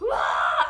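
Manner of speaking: sudden loud low cry; burst out shout. Retold or imitated. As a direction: let out a loud blurt. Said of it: "I just go".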